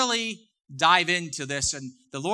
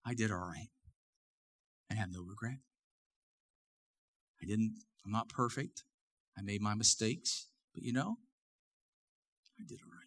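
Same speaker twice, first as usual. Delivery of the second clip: quiet contented reflection; 8 semitones lower, 11 dB quieter than usual. Retold or imitated. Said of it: "And you could say".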